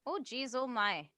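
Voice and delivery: slow, light Minnesota oh jeez oh my; a hint of Fargo Minnesota lilt; Minnesota accent. Joining in on Fargo, a mild Minnesota accent. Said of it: "his accent in Fargo was the best".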